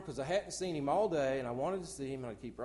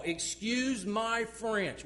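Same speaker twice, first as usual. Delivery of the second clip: mocking quote; 6 semitones higher, 4 dB louder, much slower than usual. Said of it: "bought in to Satan".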